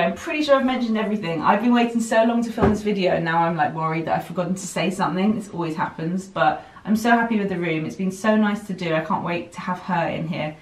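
ordinary talk, nothing marked

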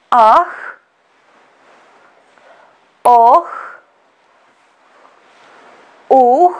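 Ah, oh, uh called out with long gaps; prompt vowels. Shown as a demonstration. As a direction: cue the vowels for repeating. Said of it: "And now your turn".

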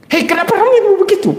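high-pitched mock scolding; hammed-up irritated churchgoer; mock angry scold. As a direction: squeak out a petty complaint. Preacher mimics angry person.

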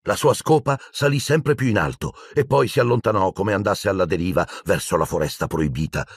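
plain reading voice

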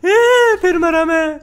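very high, loud cry of defeat; frustrated yell. Real, not acted. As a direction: yelp as you die again. Crashes in race again.

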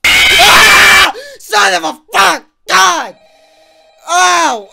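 scared scream. Real, not acted. Second jump scare, screams.

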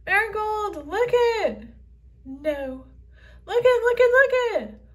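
high, loud pestering look it; excited pleading shout. Acted. Phoebe begging Marigold to look, high loud shout.

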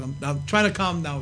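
normal talking voice

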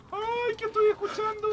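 squeaky high mock-scared cry; hammed-up scared squeal; mock fright. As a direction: fake a frightened shriek. Hears a noise in horror game.

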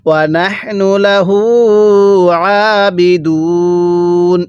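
slow, drawn-out tajweed chant; Quran recitation. Shown as a demonstration. Teacher recites the verse for students, slow tajweed chant. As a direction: intone each syllable slowly like a reciter. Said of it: "এরকমভাবে শিখব".

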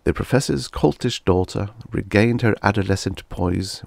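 ordinary delivery

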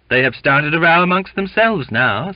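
high, loud, scornful report; disdainful. A narrator's reading. Second mate reporting to captain, disdainful. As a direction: look down on the squabbling crew. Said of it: "said Mister Creighton with disdain".

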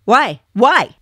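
usual speaking voice

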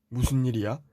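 normal talking voice

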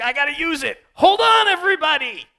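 high, full-volume mock yell; hammed-up battlefield holler, climbing very high; frantic warning shout. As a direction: yell it out like a reckless fighter halting everyone. Acts reckless fighter warning others.

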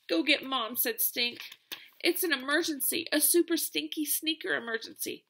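high, loud urgent plea. Acted. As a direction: call it an emergency, worked up. Stink declares emergency.

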